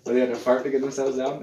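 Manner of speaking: ordinary talk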